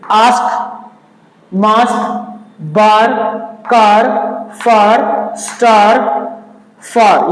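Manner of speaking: आस्क मास्क बार कार, each word drawn out as aa; aa sound demo. Shown as a demonstration. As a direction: list the aa words slowly. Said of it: "देखिए". (आ की ध्वनि वाले शब्द धीरे बोलें)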